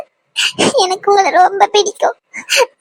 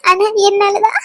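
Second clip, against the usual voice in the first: ordinary talk, nothing marked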